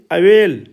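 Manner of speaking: teacher's clear model of ए in "अवेल"; vowel as Hindi ए. Shown as a demonstration. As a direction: say "अवेल" as a-VEL, second syllable stressed. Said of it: "ये है ए की ध्वनि में उच्चारण".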